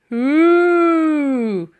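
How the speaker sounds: long loud high hoo; loud mammoth hoot; loud hooting mammoth call. A narrator's reading. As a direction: trumpet it out like a mammoth. Mammoth noise.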